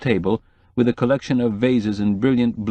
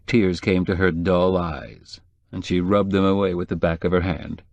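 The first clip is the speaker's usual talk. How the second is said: plain reading voice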